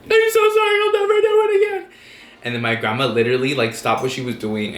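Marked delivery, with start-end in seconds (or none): 0.0-1.9 loud, very high-pitched mock crying; tearful wail. Kid apologizing to grandma, hammed-up tearful wail. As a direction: imitate a kid wailing an apology. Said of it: "I just wanted to cry".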